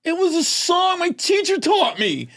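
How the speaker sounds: put-on high-pitched protesting boy; explain, wronged; boy explaining to mom; kid protesting. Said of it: "I was like".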